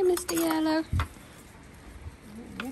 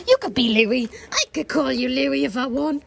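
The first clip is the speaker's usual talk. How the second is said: normal talking voice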